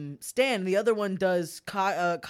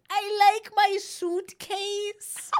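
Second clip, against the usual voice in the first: put-on very high, drawn-out little boy voice; Barron Trump; 13 semitones higher, 4 dB louder, much slower than usual. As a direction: stretch it out like a small child with a faint German accent. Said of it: "he's little and he goes".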